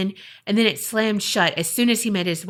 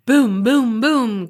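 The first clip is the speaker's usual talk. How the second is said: louder, heavy imitated footsteps; heavy booming; 2 semitones higher, 5 dB louder, slower than usual. Storyteller imitating something coming down the stairs, heavy booms. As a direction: boom it out like thudding steps. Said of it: "He said it sounded heavy".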